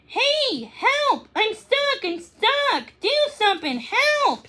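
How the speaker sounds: high, frantic cries for help; panicked puppy. Acted. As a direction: cry out like a stuck, scared puppy. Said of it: "she barked".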